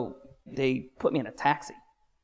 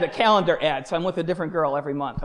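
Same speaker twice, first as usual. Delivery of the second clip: ordinary delivery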